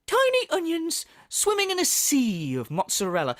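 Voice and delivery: mock excitement over food; hammed-up foodie rapture; Phil impression. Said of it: "I love how excited he gets".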